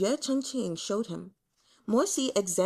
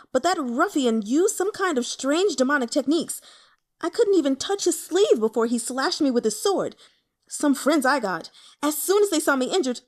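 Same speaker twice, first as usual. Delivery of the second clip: high, loud rant rising to an outraged pitch; building anger; 6 semitones higher, 6 dB louder than usual. Acted. Young man ranting about friends who ran off, growing outraged. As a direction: rant until you nearly explode. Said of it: "the angrier he got".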